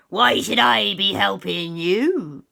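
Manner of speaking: slow, loud pirate challenge; a grumpy pirate daring them to ask; gruff pirate captain. Acted. Pirate captain to the children, slow and loud.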